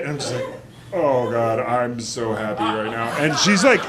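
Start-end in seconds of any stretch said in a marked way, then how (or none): 0.9-3.1 low, swooning moan of relief; blissful relief. Retold or imitated. Comic acting his own relief at the urinal, blissed out. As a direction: sigh it out in mock ecstasy.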